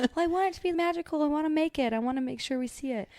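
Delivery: high, fast, eager protest; insistent. Retold or imitated. Mom answering her husband about the tree lighting, eager and insistent. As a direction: plead that it must be magical.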